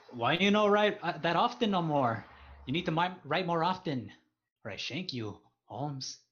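mock Mexican gangster accent. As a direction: play a Mexican street tough. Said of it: "in a Mexican accent".

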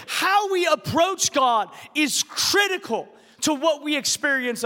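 building sermon delivery, rising to shouts; preaching. Acted. Pastor preaching to his congregation, building to shouts.

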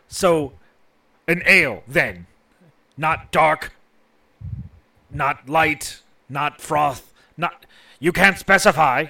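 snippy, exasperated bartender voice; sassy bartender. Acted. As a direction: sass the customer, loud and put-upon. GM voices beholder telepathic message.

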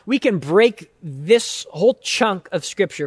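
ordinary talk, nothing marked

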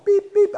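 high-pitched electronic beeping; high beeps, like hospital equipment; imitated monitor beep. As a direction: mimic a heart monitor's beeps.

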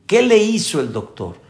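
normal talking voice

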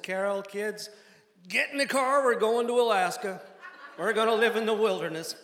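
high, loud proclamation to the family; rousing announcement. Retold or imitated. Dad tells Carol and kids they're moving to Alaska, loud announcement. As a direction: call it out like an eager order.

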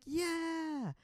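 high drawn-out mock cheer; crowd cheer. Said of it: "everybody's like".

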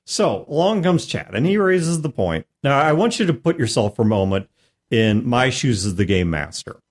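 ordinary delivery

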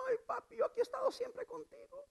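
very high, whiny mock complaint; hammed-up high-pitched grievance; sulking older son. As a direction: complain to papi like a jealous child. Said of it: "El otro hijo se enojó y dijo".

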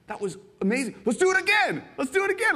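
high, loud, eager plea; can't get enough of it; eager begging. Retold or imitated. Visitor pleading to ride the tram again, high and loud.

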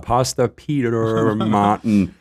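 exaggerated, drawn-out American accent; slow, hammed-up American vowels and R's; imitated American accent. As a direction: stretch the name in a mock American accent. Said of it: "impression of your accent".

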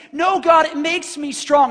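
high, loud cry of refusal; a believer refusing God, shouting and high; shouted protest. Acted. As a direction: shout back at God, clinging on.

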